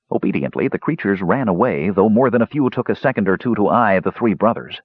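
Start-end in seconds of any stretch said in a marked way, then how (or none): none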